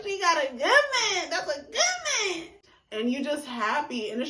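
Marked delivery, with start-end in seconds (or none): 0.0-2.5 high, loud gushing with a laugh; hype excitement. Retold or imitated. Friend praising a good man, high loud gushing. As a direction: gush and squeal over a good man. Continues hyping the couple.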